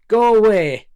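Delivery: loud Northern English shout; Northern accent. Retold or imitated. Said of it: "Sorry, my best Northern accent".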